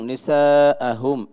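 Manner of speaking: "নিসাআহুম" high and loud, middle vowel held long; slow, ringing madd in "নিসাআহুম"; lengthened madd. Shown as a demonstration. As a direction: stretch the "saa" in "নিসাআহুম". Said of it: "আর একটু লম্বা করতে হবে".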